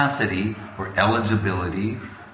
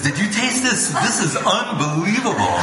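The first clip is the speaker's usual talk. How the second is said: much higher, laughing wonder; rapturous guru; 9 semitones higher than usual. Retold or imitated. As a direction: gush over the taste. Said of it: "He goes".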